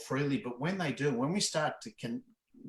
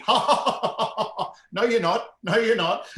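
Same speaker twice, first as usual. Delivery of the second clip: loud, higher laughing no you're not; defiant laugh back; 8 semitones higher, 7 dB louder than usual. Retold or imitated. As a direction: laugh off the threat. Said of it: "my response had to be".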